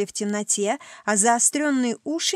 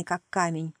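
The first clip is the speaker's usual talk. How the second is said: plain reading voice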